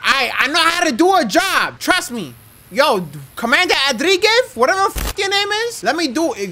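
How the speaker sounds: high, insistent self-defence; indignant protest. Pretend. Player protesting to the commander, high indignant voice.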